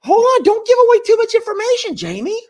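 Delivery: high squeaky mock-scold, fast; teasing falsetto scold. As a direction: playfully shush her in a squeaky voice.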